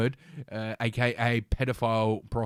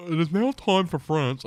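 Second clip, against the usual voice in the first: higher, put-on quoting voice; 5 semitones higher than usual. Quoting the mayor in a mistaken voice, put-on and higher. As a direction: quote it in the wrong funny voice. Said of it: "why am I doing that voice".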